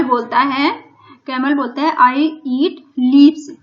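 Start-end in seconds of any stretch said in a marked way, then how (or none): 2.0-3.6 very slow, louder, every word spaced out; slow dictation. Shown as a demonstration. Teacher reading the story line to Hindi-speaking pupils, very slow and loud. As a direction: read it word by word for the class. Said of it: "teacher reads camel's line slowly".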